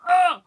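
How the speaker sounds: loud, high exasperated groan; frustrated groan. Retold or imitated. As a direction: let out a big fed-up ugh. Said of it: "And so I was just like".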